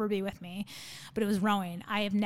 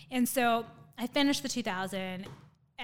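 ordinary talk

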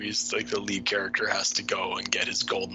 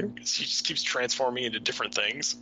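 normal talking voice

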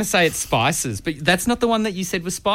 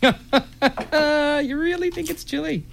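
incredulous; 11 semitones higher, much slower than usual. Real, not acted. Teasing disbelief at chili guess.

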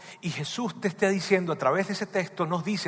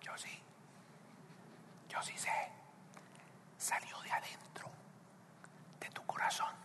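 soft knowing whisper, barely voiced; Jesus whispering; 20 dB quieter than usual. Jesus whispering to the ashamed speaker, soft whisper. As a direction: whisper it gently, as one who knows the heart. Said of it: "Jesús, que está cerca, te diría".

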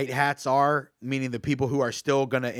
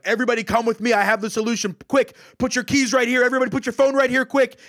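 high, loud, fast rallying call; urgent mock order; 8 semitones higher, 4 dB louder than usual. Fake fireman ordering a crowd out of a fire, urgent mock command. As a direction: imitate a fake rescuer rushing everyone along.

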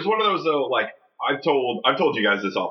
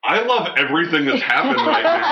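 louder, higher, laughing delight; excited laughing; 7 semitones higher, 5 dB louder than usual. Retold or imitated. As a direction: gush with laughing joy. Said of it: "I was like".

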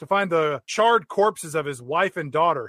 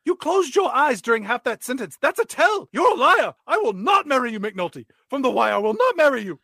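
indignant princess; 6 semitones higher than usual. Acted. Princess rejects the lying suitor, indignant and higher. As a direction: call him out and refuse him.

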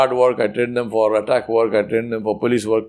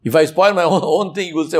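ordinary talk

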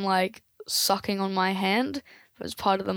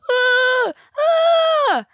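high wild screams; mad cries; 6 dB louder than usual. Acted. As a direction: scream like a man losing his mind. Explorers driven mad.

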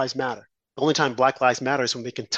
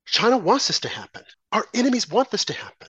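ordinary talk

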